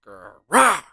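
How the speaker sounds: loud growling roar. Acted. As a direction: roar as you attack. Monster attack roar.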